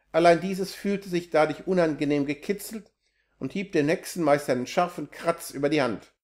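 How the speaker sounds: plain reading voice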